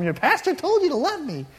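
high, put-on voice of a nagging spouse; mock nagging spouse. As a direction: tease the congregation with a whiny spouse voice.

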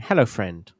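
ordinary delivery

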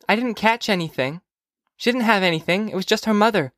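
put-on high little-girl protest; young girl Phoebe. Phoebe assuring mother she caught nothing, high girlish voice. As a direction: insist in a high child's voice.

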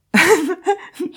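high, real burst of laughter; amused laugh. Real, not acted. Vlogger laughing at someone annoyed by elevator stops, amused. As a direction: laugh at the absurdity.

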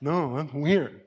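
put-on a teenager waving off the idea, softly; the pastor voicing his teen refusing to pray with dad; dismissive teen. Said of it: "They're gonna be like".

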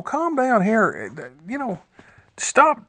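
high, mock-impatient shushing of a noisy man; hushing crowd. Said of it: "they was basically saying".

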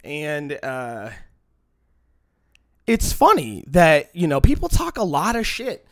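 ordinary talk, nothing marked

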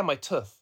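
"tooth" with a short vowel, like "tuff"; old Midlands pronunciation. Shown as a demonstration. Insisting to puzzled people, "tooth" said as "tuff". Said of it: "the other one is teeth".

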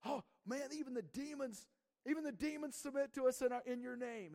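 excited voice of the returning disciples; amazed report. Retold or imitated. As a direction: gush like amazed followers reporting back. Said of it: "They came back and they said".

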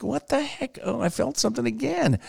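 high and hushed, caught off guard; a high, hushed double take; dismayed surprise. Retold or imitated. Feeling tooth pain again.

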